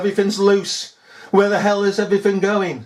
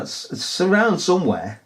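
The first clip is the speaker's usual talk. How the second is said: ordinary talk, nothing marked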